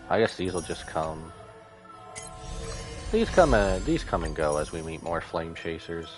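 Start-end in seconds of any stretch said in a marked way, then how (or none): none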